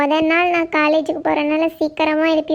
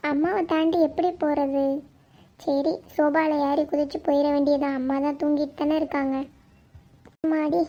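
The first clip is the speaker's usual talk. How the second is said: soft, lowered sneaking voice; hushed self-talk; 3 semitones lower, 6 dB quieter than usual. Acted. Daughter plotting to slip past sleeping mother, hushed. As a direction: keep it down, plotting to yourself.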